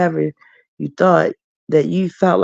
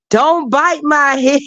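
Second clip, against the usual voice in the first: imitating Leandra; 10 semitones higher, slower than usual. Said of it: "She said".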